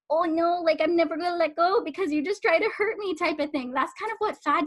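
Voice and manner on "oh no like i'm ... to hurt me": higher-pitched mock alarm; alarmed body. Coach voicing a body shocked by dieting, mock alarm. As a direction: protest in a put-on, mildly panicked voice.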